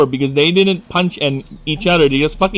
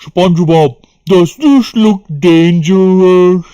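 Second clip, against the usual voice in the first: loud, slow, drawn-out cartoon voice; SpongeBob quote; 3 semitones higher, 7 dB louder, slower than usual. Streamer quoting the SpongeBob hooky episode, loud and slow. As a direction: ham up the cartoon line. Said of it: "Remember that?".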